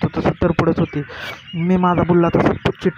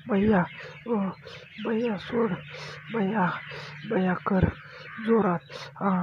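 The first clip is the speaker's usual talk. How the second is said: higher, softer imitated cries; narrated crying out; 4 semitones higher, 7 dB quieter than usual. A narrator's reading. Narrator voicing the character's cries, high and soft. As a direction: break it into short, high, hushed calls. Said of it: "ती ओरडत होती".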